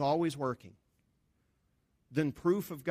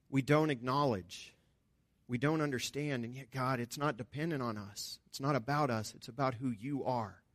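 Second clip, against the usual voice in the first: prayer; 4 semitones lower than usual. Real, not acted. Closing prayer.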